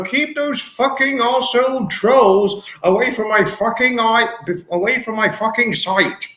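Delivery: high, blustering mock command; hammed-up celebrity impression; Brian Blessed. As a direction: declaim it theatrically, pitched well up. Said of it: "He'd probably say that".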